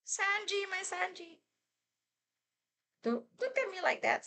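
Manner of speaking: high, breathless gushing over a crush; fangirl squealing. Real, not acted. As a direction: swoon over him. Swooning over Sanji casting.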